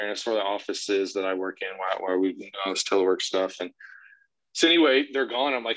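ordinary talk

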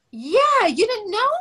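surprised friend. Retold or imitated. Maxine surprised Dawn didn't know.

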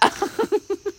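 quick high-pitched laugh. Real, not acted. Laughing at her own outburst at the twin flame, high laugh.

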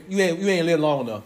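usual speaking voice

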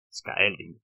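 puzzled echo, pitch falling; confused repeat. A narrator's reading. Kurt echoing an unknown word, mildly confused. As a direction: repeat the strange word, baffled. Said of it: "ripeté Kurt, confuso".

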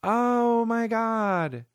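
high, breathless swooning over the groom; mock lovestruck sigh; swooning woman. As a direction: gush like a smitten royal-wedding fan. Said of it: "women watch it with their hands on their fucking chest".

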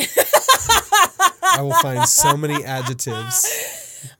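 high, slow, loud laughing declaration; mock-threaten through laughter; drawn-out laughing boast. Real, not acted. Laughing threat to keep rephrasing.

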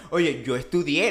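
usual speaking voice

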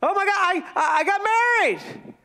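high, gushing newlywed thrill; excited. Acted. Newlywed announcing the wedding, excited. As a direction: burst out with hammed-up delight. Acting newlywed joy, then letdown.

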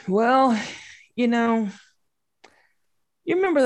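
high, halting nervous start; nervous hesitation. Retold or imitated.

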